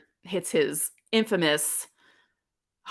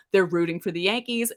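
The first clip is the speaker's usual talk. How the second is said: ordinary talk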